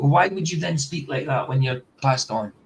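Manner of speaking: ordinary talk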